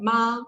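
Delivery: MA held high and level; first tone. Shown as a demonstration. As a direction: keep MA on a steady high pitch. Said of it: "speak in the first tone".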